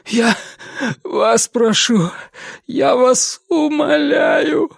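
high, slow tearful begging; crying plea. Acted. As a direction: sob out the plea. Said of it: "заплакал Вивьен".

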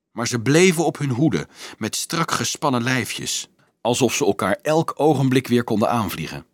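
ordinary delivery

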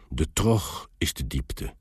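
slightly winded dwarf's voice; a touch out of breath from poling; panting dwarf. A narrator's reading. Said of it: "zei de dwerg hijgend". (licht hijgend)

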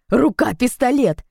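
loud, high, slow savouring cry; excited boy's shout, each word stretched; delighted exclamation. A narrator's reading. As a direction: blurt it out in thrilled awe. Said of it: "с восторгом воскликнул Фред".

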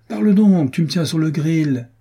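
a friend on tenterhooks, loud and high; impatient. A narrator's reading. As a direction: press him eagerly for the news. Impatient friend presses for news.

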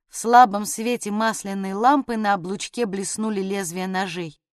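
ordinary delivery, nothing marked

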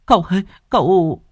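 high, loud crying call. Acted. Servant girl catching the fallen young master, high crying call. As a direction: cry out to him as he falls. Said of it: "Tư Hiền khóc lóc".

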